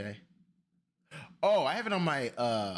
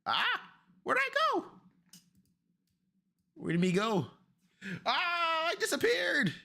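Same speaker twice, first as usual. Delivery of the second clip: startled. Real, not acted. Lost his camera feed.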